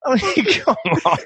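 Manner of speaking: high, laughing chatter. Real, not acted. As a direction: talk through the laughter. Laughing over silly film.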